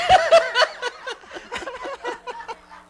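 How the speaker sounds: high, loud, long laugh; real laughter. Real, not acted. Laughing after joking to Tony about paying for the trip, long high laugh. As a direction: laugh hard at your own joke. Laughing at his own joke.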